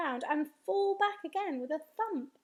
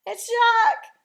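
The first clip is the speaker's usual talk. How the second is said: high, booming cry of terror; bellowed; 9 semitones higher, 16 dB louder than usual. A narrator's reading. Giant dreaming of Jack, terrified bellow. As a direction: bellow the name in panic. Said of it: "bellowed the flesh lump eater".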